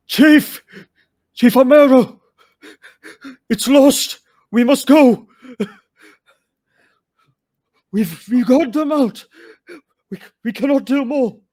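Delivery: high, loud panicked shouts over a storm; desperate yelling. Acted. Warrior yelling to the chief as the village is lost, frantic shout. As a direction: yell to the chief through the chaos. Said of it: "a voice yelling out".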